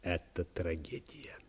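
slow, very soft and low, almost whispered; hushed awe. A narrator's reading. Secretary overcome by burbot liver, in hushed awe. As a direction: drop to a reverent near-whisper.

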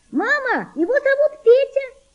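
put-on very high girlish call; girl's shout. A narrator's reading. Girl reports boy's name to mother, high shout. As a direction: call out to mum, piping high. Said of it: "закричала девочка".

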